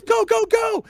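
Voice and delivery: high, loud urgent shout; Corporal Macron urging. Acted. Game master voices a corporal on the ladder, urgent shout. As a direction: yell it to hurry the squad up. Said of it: "He goes".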